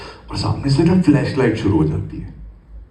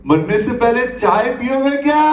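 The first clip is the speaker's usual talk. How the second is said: woman shouting; 12 semitones higher than usual. Retold or imitated. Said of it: "वो जोर से चिल्ला के बोलती है".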